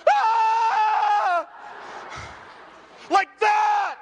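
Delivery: piercing high shouted Ah; demo scream. Shown as a demonstration. Preacher demonstrates the scream he wants from the crowd. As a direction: yell it out full force, high and loud. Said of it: "I want you to be like".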